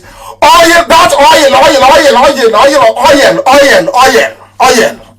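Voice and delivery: "oil" stretched into "oyel", sung out high; mocking chant of "oil" at the oil-hungry; mocking drawled oil. Real, not acted. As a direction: chant "oil" over and over in mockery. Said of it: "O Y E L".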